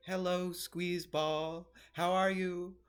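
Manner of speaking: slow, high, gentle sing-song greeting; mock peaceful YouTuber voice; soothing YouTuber voice. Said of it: "who talks more like this".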